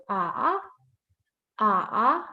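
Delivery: A with a low hook in the voice; dipping third tone. Shown as a demonstration. Teacher stressing the third tone, A dipping then rising. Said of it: "Like a hook in your".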